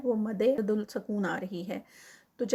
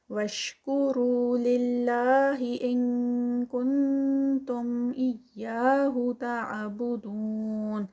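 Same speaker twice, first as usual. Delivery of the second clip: slow, louder melodic chant; Quran recitation; 4 dB louder, slower than usual. Shown as a demonstration. Teacher reciting the verse aloud, slow and melodic. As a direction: recite with held vowels and ghunna. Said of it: "recites the verse".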